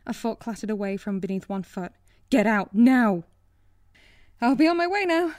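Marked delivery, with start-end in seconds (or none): 2.3-3.2 loud urgent command; shouting. Acted. Cook threatens, very loud. 4.4-5.3 high, loud nervous babble; babbling. Acted. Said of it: "she babbled".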